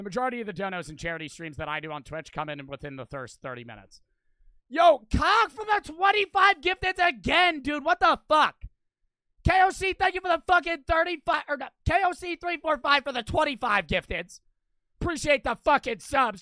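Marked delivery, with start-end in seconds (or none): 4.7-16.4 loud, high, amazed thank-you shout; excited shout. Real, not acted. Streamer thanks a viewer for twenty-five gifted subs, excited shout. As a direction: yell it out, thrilled and stunned by the gift. Big gift lands, streamer calls out.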